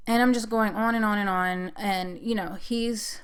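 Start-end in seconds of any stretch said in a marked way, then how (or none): none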